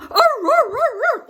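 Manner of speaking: very high, loud howls; bay like the hounds on the run; dogs howling. Retold or imitated. Imitating dogs running off.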